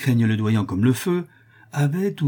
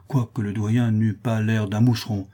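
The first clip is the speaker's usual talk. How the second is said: ordinary delivery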